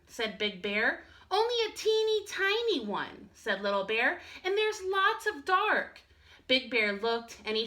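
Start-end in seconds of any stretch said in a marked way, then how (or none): 1.3-3.3 higher small cub voice; a tiny scared cub, pitched up; little bear cub. Acted. Little Bear tells Big Bear he is scared, high small voice. 4.4-6.0 higher small cub voice; a tiny scared cub, pitched up; little bear cub. Acted. Little Bear tells Big Bear he is scared, high small voice.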